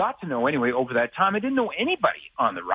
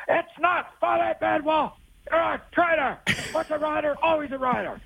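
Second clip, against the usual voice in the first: mock heckler yell; 14 semitones higher than usual. Rider fan yelling at the sportscaster in Stamps jersey, high mock yell. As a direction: play the fired-up fan who won't let it go. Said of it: "But he starts".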